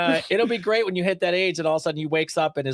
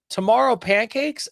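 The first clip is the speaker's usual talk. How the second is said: put-on higher, slow little-kid question; slow, raised toddler voice with few words; small child asking; 7 semitones higher, slower than usual. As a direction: lightly imitate a toddler asking hopefully. Voices small son's halting question.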